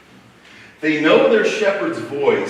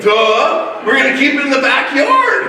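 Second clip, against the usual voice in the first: higher-pitched retold "duh" comeback; mock obvious retort; 7 semitones higher, 4 dB louder than usual. Husband answering wife's objection to the sheep, mock obvious retort. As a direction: act out stating the blindingly obvious.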